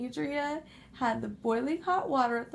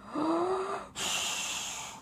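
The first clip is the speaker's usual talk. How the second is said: frightened gasp; 7 semitones higher than usual. Acted. Pigs gasp as house shakes.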